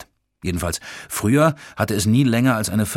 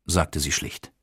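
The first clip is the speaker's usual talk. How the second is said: plain reading voice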